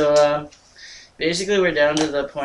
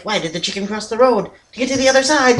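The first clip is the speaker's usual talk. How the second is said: high, fast, laughing cheesy-joke voice; imitating the leprechaun; 8 semitones higher, faster than usual. Friend mimics the leprechaun telling a cheesy joke, high and giggling. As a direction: toss off the corny joke as a giggling imp. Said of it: "He's like, he's like".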